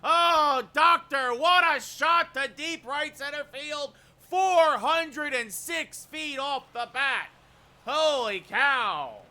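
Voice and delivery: stretched-out broadcaster yell, building then easing off; home run call. Real, not acted. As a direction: scream it like a walk-off bomb.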